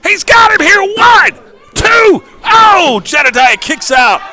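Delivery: high, shouted ringside call; wrestling commentator. Real, not acted. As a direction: yell the near fall live. Live wrestling play-by-play.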